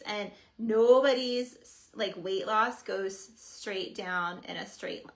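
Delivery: ordinary delivery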